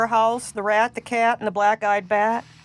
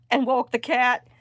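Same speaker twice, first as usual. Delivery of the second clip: ordinary delivery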